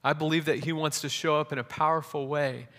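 rising, fervent sermon build over music; preaching with music. Real, not acted. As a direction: preach it with growing conviction.

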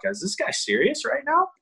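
as if the coach has lost his mind; incredulous. Retold or imitated. Player staring at calm coach predicting the hit, incredulous high question.

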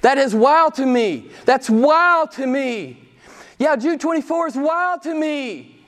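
high, loud, amazed quoting of his wife. Retold or imitated. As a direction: echo her wonder, stretched out. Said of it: "She said".

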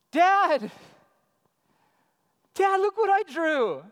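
put-on high, loud kid's call; imitated eager child. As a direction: play a child showing Dad a drawing. Acts child calling to dad.

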